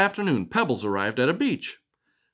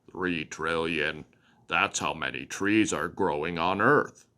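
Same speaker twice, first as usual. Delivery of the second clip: croaking frog; 8 semitones lower, 5 dB quieter than usual. Acted.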